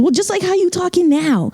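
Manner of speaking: high-pitched retort in a classmate's voice; mocking schoolmate. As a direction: imitate a peer pointing out the obvious. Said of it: "it was just like that".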